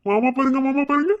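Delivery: high, loud sing-song cooing; cooing baby talk. Acted. Uncle hushes crying newborn, cooing baby talk. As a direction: coo brightly to hush a baby.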